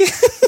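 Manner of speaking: high squealing laugh, real; burst of laughter. Real, not acted. Laughing at the ban on Tschüssikowski, high squeal. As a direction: let a giggle burst out high.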